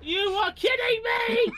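high drawn-out wailing yell; freak-out. Real, not acted. Player in disbelief at the game, high wailing freak-out. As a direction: wail it slowly in disbelief. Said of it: "Someone clip his freak out".

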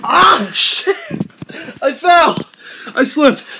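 loud, high, shaken cries after a fall; pained shout. Real, not acted. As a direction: yell out from the slip. Just slipped in the snow.

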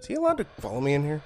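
panicking gamer. Real, not acted. Chased by guard in game.

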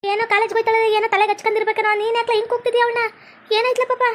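a worried aunt asking what happened; fussing aunt. Acted.

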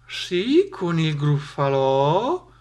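drawn-out, rising question on "gruffalò"; puzzled question. A narrator's reading. Snake asks the mouse about "gruffalò", questioning.

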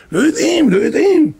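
high pained cry of dismay; anguished exclaim. Real, not acted. Preacher crying out to the congregation in dismay. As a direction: cry it out as if it hurts. Preacher laments children's ignorance.

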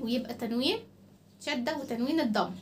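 ordinary delivery

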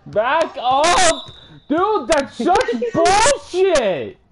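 high, drawn-out outraged yell; angry protest. Real, not acted. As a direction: yell it high at an unfair shot. Protests a shot in game.

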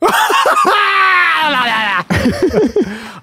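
long, shrieking burst of laughter; uproarious laugh. Real, not acted. Cracks up at the joke.

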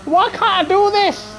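real exasperation, voice pitched right up; frustrated. Real, not acted. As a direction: wail it at yourself after botching the race. Frustrated at own driving mistakes.

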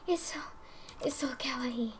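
breathy whispered gush; soft whispered adoration; awed whisper. Real, not acted. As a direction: hush it like it's too cute to say aloud. Overwhelmed by cute item.

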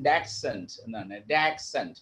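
dachshund said as DAK-sunt; correct pronunciation. Shown as a demonstration. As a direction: model dachshund with a k-s in the middle. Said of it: "അതിന്റെ pronunciation".

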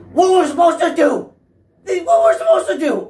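high and loud, rising to a squeal; exasperated yell. Real, not acted. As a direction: cry out in disbelief at Sony. Ranting at Sony about delay.

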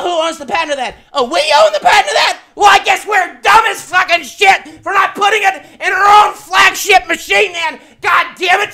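loud, high, shouting outrage building to a scream; mock-sarcastic fury cracking into shouts; furious rant. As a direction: blow up at Sony in a full rage. Building rant against Sony.

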